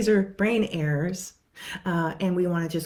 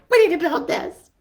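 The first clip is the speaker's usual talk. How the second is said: much higher and louder, eager; eager client; 12 semitones higher, 9 dB louder than usual. Retold or imitated. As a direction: declare it with delight.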